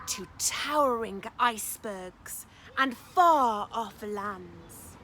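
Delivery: put-on picture-book reading for children; lively storytime narration; story narration. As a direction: read it aloud with wonder for little ones. Reads picture book aloud.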